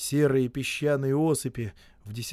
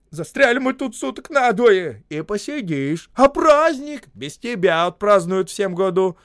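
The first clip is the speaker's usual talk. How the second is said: loud retort; 9 semitones higher, 9 dB louder than usual. A narrator's reading. Foreman shouts back.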